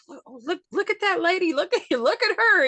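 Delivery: high, loud mock gawking with a laugh; pointing onlookers. Fans at WrestleCon pointing at the granny shirt, excited high calling out. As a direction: play a delighted stranger spotting her in the crowd. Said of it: "people were pointing at me like".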